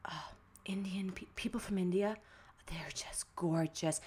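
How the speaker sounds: breathy, awed whisper as grandma; whispered grandma quote. Retold or imitated. Retelling grandma's praise of Indian girls, awed whisper. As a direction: imitate grandma marvelling, whispered and slowing right down.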